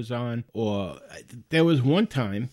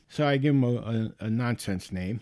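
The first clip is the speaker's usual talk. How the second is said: normal talking voice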